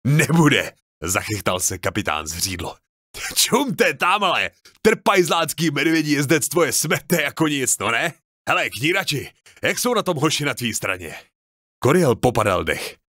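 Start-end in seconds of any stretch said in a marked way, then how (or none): 0.1-0.7 loud retort. Acted. Captain retorts amid battle. 3.3-11.2 high, excited boasting soldier; gleeful captain. Acted. Captain boasts to the mustached veteran about dwarf riders, gleeful high voice.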